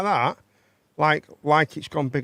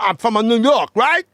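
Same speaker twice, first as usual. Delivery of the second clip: loud, higher New York American accent; brash New Yorker showing off; hammed-up New York accent; 7 semitones higher, 9 dB louder than usual. Shown as a demonstration. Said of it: "that's my New York".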